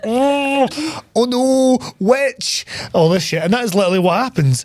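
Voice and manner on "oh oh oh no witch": high, drawn-out mock dread; mock horror. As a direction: wail it like a spooked villager. Imitating horrified pub crowd seeing him.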